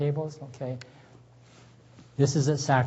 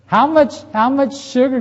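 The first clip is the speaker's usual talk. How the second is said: eager quiz; 11 semitones higher, 9 dB louder than usual. Acted. Teacher's sudden high quiz question.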